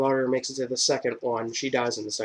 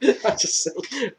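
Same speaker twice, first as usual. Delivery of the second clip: laughing; 10 semitones higher, 3 dB louder, much slower than usual. Real, not acted. Laughing excuse after remark.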